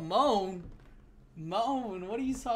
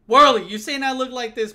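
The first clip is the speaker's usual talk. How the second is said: louder, offended challenge; loud indignant; 3 semitones higher, 10 dB louder than usual. Real, not acted. Gamer calling out friend Worley over an image, loud and offended. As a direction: call out the friend by name.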